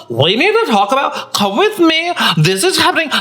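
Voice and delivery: hammed-up viral creator; viral influencer voice. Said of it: "a lot of people".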